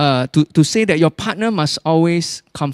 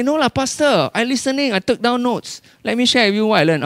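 eager congregant; 6 semitones higher than usual. Retold or imitated. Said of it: "but you say".

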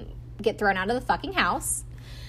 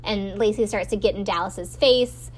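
ordinary delivery

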